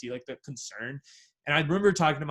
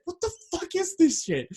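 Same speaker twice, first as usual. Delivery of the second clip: baffled; 14 semitones higher than usual. Retold or imitated. Retells outrage at news.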